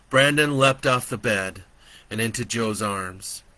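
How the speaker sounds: ordinary delivery, nothing marked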